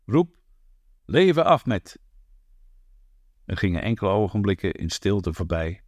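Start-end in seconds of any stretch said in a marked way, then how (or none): 0.0-2.0 higher, louder order to cheer leven Ahmed; commanding call. Acted. Ahmed orders the crowd to cheer him, loud and commanding. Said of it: "riep toen op bevelende toon".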